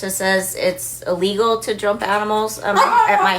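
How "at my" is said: laughing. Real, not acted. Laughing about the sign.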